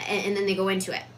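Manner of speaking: ordinary delivery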